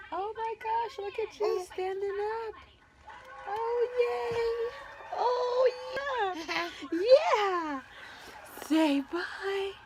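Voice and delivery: very high, delighted cooing voice. Real, not acted. Mother to baby standing up and waving bye, high baby talk.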